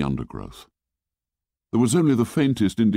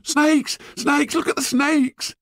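put-on very high-pitched little boys' alarm; screaming. A narrator's reading. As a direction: shriek a warning like frightened little boys. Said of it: "The little boys screamed at them".

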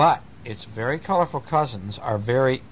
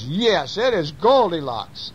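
lightly voiced bear, pitched up; mildly acted daddy bear; daddy bear exclaiming; 7 semitones higher, slower than usual. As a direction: confirm it with story-time emphasis. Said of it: "And Daddy Bear says".

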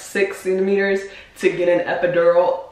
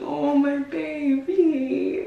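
tender; 7 semitones higher, 3 dB quieter, much slower than usual. Real, not acted. Tender moment at birth video.

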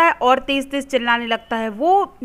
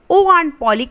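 louder, higher call for the aunt; crying out; 4 semitones higher, 6 dB louder, much slower than usual. Retold or imitated. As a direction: call her urgently from downstairs. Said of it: "cried Sid". (ज़ोर से पुकार)

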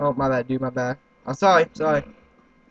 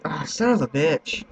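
usual speaking voice

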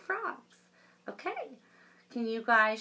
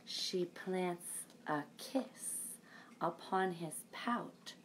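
whisper; 9 dB quieter, slower than usual. A narrator's reading. Fish plants a kiss.

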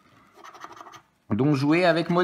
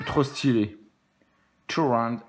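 ordinary delivery

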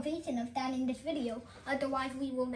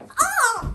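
startled yelp; 11 semitones higher, 11 dB louder than usual. Real, not acted. Repeats the physical bit.

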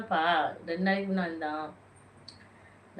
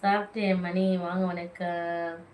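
ordinary talk, nothing marked

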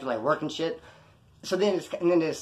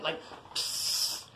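dismissive psst; 9 dB quieter than usual. Pretend. Miming a dismissive spit sound.

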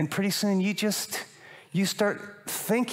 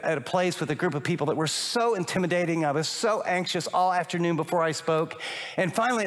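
ordinary talk

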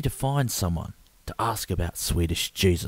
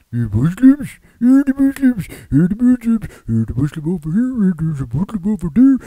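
high, loud cartoonish gabble; frantic babbling; 11 semitones higher, 9 dB louder than usual. Stranger ranting after a question about Muslims, frantic high babble. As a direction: erupt into a hysterical nonsense rant.

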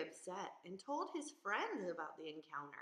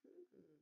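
thoughtful hum; 9 semitones higher, 21 dB quieter than usual. Acted.

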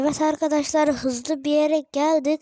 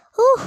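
high, loud sharp gasp; startled gasp; 11 semitones higher, 12 dB louder than usual. Real, not acted. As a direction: gasp in surprise. Sudden gasp.

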